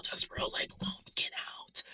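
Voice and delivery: hushed, voiceless whisper; whispered aside. Real, not acted. Storyteller on the stubborn mouse who stays, whispered aside.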